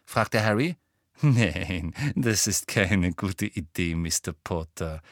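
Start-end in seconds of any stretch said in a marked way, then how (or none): 1.2-5.0 slow, low, sugary refusal; sugary sweet. A narrator's reading. Umbridge refusing Harry the wand, sugary sweet. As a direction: draw it out with syrupy condescension. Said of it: "sagte Umbridge süßlich".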